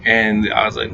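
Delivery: ordinary delivery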